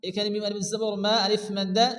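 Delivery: ordinary talk, nothing marked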